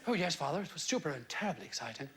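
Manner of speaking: put-on lightly posh English accent, father and son; a hint of plummy English, a vicar voicing his boy. Radio vicar retells a chat with his son, light posh English accent. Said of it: "Pretty good English accent".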